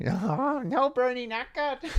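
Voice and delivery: high, softening mock female politician; AOC. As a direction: climb high and thin in dismay. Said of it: "A O C?".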